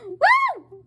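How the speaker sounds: a very high, loud joyful woo; excited whoop. Real, not acted. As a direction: let out a cheer. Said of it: "I'm excited".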